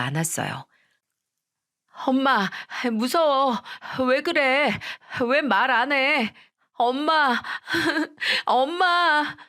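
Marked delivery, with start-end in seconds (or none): 2.1-9.4 put-on a scared little kid begging mom to answer; crying child. Eldest child begging a silent mother, crying. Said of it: "이렇게 울면서 이야기를 하는데".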